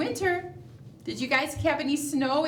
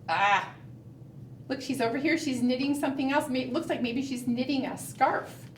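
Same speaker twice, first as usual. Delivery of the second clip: ordinary delivery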